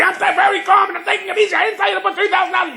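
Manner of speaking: high, fast mock-agitated insisting on calm. Owner insisting he's calm while shrieking at the mechanic, high and fast. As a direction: protest too much, high and hurried. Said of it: "You calm down".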